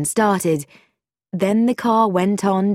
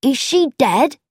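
put-on higher, eager boyish question; happy relish; 7 semitones higher, 3 dB louder than usual. A narrator's reading. Boy delighted by the accident, happily eager. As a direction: ask with cheerful, lurid curiosity. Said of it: "the boy said happily".

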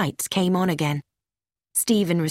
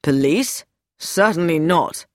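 irritated refusal; 2 semitones lower than usual. Acted. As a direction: refuse outright, affronted. Lewis rejects calling the police.

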